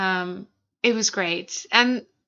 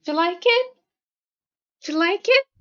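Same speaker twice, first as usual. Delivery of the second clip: pet talk; 9 semitones higher, faster than usual. Real, not acted. Talking to her cat.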